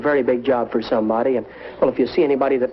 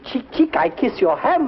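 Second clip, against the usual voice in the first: much higher, hammy French gallant; high flirtatious; 11 semitones higher than usual. Acted. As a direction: squeak it out like a smitten Frenchman.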